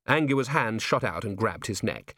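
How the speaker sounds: plain reading voice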